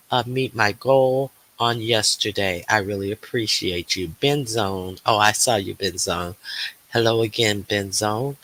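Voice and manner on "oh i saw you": ordinary delivery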